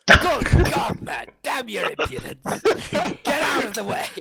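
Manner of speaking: high, loud outraged captain; hammed-up indignation, pitched high and loud; indignant bluster. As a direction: bluster like an affronted officer. Player's captain blusters at guard.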